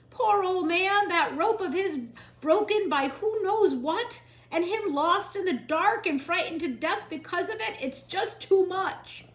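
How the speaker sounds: loud, high distressed wail; lamenting. Acted. Cook grieving loudly over the old man. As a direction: cry out in grief.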